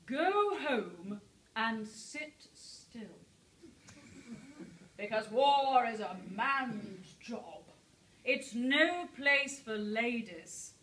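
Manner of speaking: slow, heavy, patronising brush-off; the authorities turning the doctor away, slow and condescending; dismissive official. Retold or imitated. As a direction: drag each word out like a condescending bureaucrat. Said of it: "and I quote".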